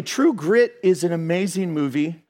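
normal talking voice

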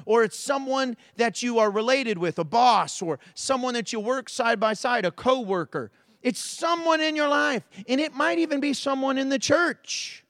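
a pastor building up his sermon; preaching. Acted. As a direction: preach it out to the congregation.

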